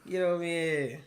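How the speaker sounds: a bad, high, loud Boston accent; horrible Boston accent. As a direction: butcher a Boston accent on purpose. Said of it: "my horrible Boston accent".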